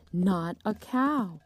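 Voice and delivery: plain reading voice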